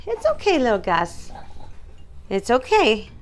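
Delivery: high cooing reassurance to a scared dog; calm him with a sweet high voice; soothing a frightened dog, high and gentle; soothing pet talk. Real, not acted.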